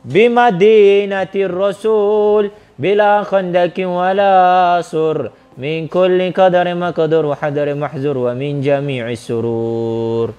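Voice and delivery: slow melodic Arabic recitation, long drawn vowels; intoned religious chant; prayer recitation. Real, not acted. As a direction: chant the prayer with sustained, ringing syllables. Recites an Arabic prayer before questioning.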